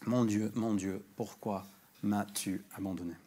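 soft, sinking quote of the cry; whispered-down lament; hushed quote. Retold or imitated. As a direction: let the words fall away quietly. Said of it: "il crie ces paroles".

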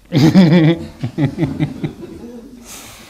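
bursting out in a high laugh; a real high giggle breaking out; high loud laugh. Real, not acted. Amused by his own image.

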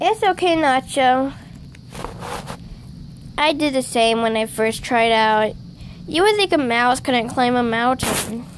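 usual speaking voice

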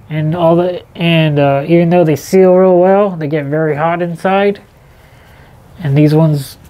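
muffled behind cloth mask; speaking through respirator mask; through a face mask. Real, not acted.

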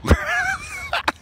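high giggling chuckle; real laugh. Real, not acted. Storyteller amused by his own tale, laughing. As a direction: crack up at your own story.

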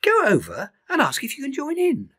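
high, bright cheerful bird voice; a friendly bird cheering him on; bird Iris. Acted.

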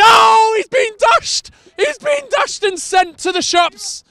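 high, loud delighted commentary cry; excited shout. Real, not acted. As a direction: burst out as the defender gets beaten. Player beaten, commentator erupts.

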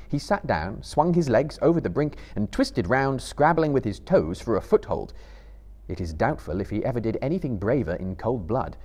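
ordinary delivery, nothing marked

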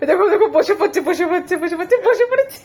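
high, loud laughing talk. Real, not acted. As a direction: laugh while you describe it. Laughing at dizzy toddler.

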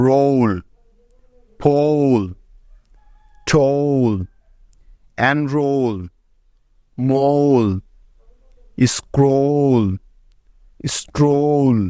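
রোল, পোল, টোল said slowly, one at a time, with ও gliding to ঔ; example words with ou. Shown as a demonstration. Teacher reads the double l example words to the class with ঔ. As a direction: stretch স্ক্রোল very slowly and make its ঔ glide clear. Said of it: "কিছু double L এর উদাহরণ".

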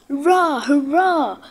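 high, gleeful hurrah of mischief; gleeful cheering. A narrator's reading. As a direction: cheer as the trucks push him. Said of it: "laughed the trucks".

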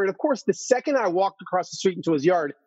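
ordinary delivery

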